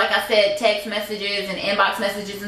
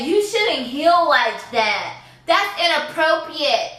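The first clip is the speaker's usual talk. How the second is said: slow, higher, preachy mimicry; mocking quote; 5 semitones higher, slower than usual. Mimicking relatives who tell her how to heal, slow mocking voice. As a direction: drag it out like a scolding relative, mocking. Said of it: "don't ever fix your mouth and say".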